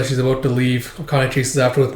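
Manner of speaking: usual speaking voice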